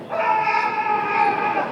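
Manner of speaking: high, loud squealing Pee Wee cry; Pee Wee Herman yell. Retold or imitated. Kid on stage doing his Pee Wee Herman, loud high yell. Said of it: "that was my Pee Wee Herman".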